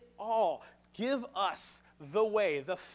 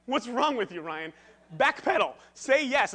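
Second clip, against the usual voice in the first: panicked inner voice; 4 semitones higher, 8 dB louder than usual. Retold or imitated. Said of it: "I'm like".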